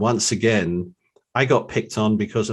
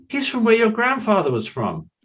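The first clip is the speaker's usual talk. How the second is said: delighted; 14 semitones higher, 4 dB louder than usual. Retold or imitated. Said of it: "owner tells son, laughing".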